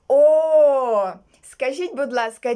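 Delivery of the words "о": loud, long, rounded "О"; exclaimed "oh". Acted. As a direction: let out a big, held "oh". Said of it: "І Тім відповів". (гучне протяжне «О!»)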